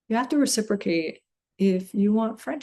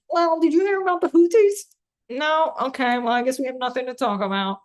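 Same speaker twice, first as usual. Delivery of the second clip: high, eager mock gloom-monger voice; mock Debbie Downer; 6 semitones higher, 4 dB louder, faster than usual. Doom and gloom friend answering a caller, high mock eagerness. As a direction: pitch it way up like a friend too keen to share bad news. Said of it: "I'm gonna be that Debbie Downer".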